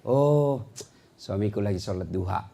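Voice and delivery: soft, low trusting reply; trusting wife. Acted. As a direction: think the best of him, gently. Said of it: "sangka baik".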